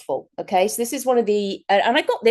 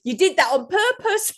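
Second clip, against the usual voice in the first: accusing husband; 9 semitones higher than usual. Retold or imitated. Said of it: "And then he's going".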